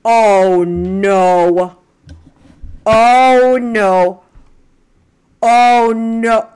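loud, drawn-out overwhelmed cry. Real, not acted. Fan overwhelmed at the concert, loud overwhelmed cry.

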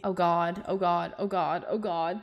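soft, panicked "oh God" under the breath; quietly freaking out; scared muttering. Real, not acted. Streamer panics quietly at being hacked back, soft and afraid.

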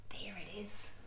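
hushed whisper. Acted. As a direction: breathe it like a secret. Showing the violin softly.

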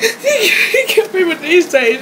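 loud, tearful outburst; distraught outburst. Real, not acted. Distraught outburst about a killing.

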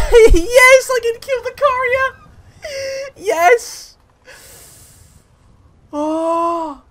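high, loud squeal of glee; excited squeal. Real, not acted. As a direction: shriek with delight. Gleeful at killing the courier.

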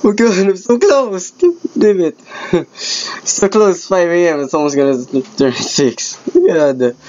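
high-pitched, distressed wailing; anguished frustration. Real, not acted. Player so close to six AM loses, high anguished complaint. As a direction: lament a narrow loss in a high voice. Killed just short of winning.

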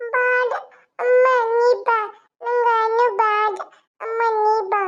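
put-on high, slow toddler wail; crying little girl. Daughter protesting as mother leaves for duty, high wailing. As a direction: drag out each plea in a child's voice. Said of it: "ದಿನ ಅವ್ಳು ಹೋಗುವಾಗ ಅಳ್ತಾಳೆ".

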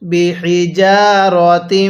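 বিহিজারতিম stretched slowly, joined to মিম; slow recitation. Shown as a demonstration. Teacher reciting slowly for students to repeat. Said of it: "তাকে মিমের সাথে লাগাবো".

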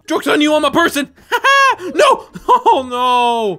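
loud high cackling laughter; laughing shout. Real, not acted. Streamer laughing as a human again, loud.

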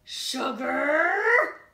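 growled name. Acted. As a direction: growl her name as a warning. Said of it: "growled Dirt".

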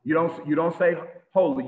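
normal talking voice

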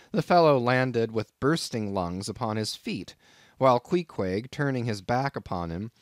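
ordinary delivery

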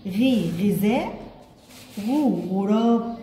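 slow, drawn-out "غي" before "غذاء", "غو" before "غراب"; sounding out syllables. Shown as a demonstration. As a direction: stretch the syllables "غي" and "غو", then say "غذاء" and "غراب" slowly. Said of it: "لأن أنا بقول غي". (نطق تعليمي بطيء مع مد "غي" و"غو")

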